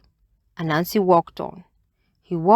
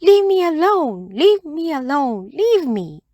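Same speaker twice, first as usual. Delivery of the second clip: high, loud squeaky bird voice snapping leave me alone; a tiny bird shooing off a pest, high and cross; annoyed little bird scolding in a high chirpy voice; 10 semitones higher, 4 dB louder than usual. A narrator's reading. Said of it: "crossly saying".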